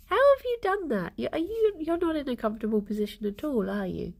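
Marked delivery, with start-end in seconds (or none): none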